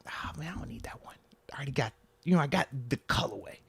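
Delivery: hushed unvoiced aside to himself; breathy murmured shrug; whispered inner thought. Inner thought about skipping sneakers.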